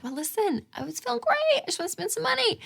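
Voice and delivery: high, soft, put-on excuse; hammed-up self-justifying squeak; making an excuse. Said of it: "make it an excuse and be like".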